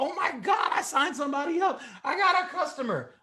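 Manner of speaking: hammed-up high-pitched celebration; mock giddy excitement. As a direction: gush like someone thrilled by a first sale. Mock excited new recruiter.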